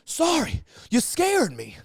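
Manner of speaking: high-pitched imitation of a startled guest; big, excitable apology, imitated; Harry Carey. Drunk guest apologising to the host, Harry Carey energy. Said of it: "Imagine Harry Carey. It, it, that is the, that's the energy".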